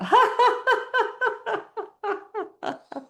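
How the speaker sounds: very high laughter, trailing off; drinking laugh. Shown as a demonstration. Drinking it up while laughing, high fading laughter. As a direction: burst high, then let it fade. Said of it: "drink it up while laughing".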